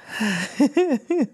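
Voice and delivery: a short laugh to keep from crying; teary laugh. Real, not acted. Host laughing off her tears for listeners, teary laugh. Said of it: "laugh to keep from crying".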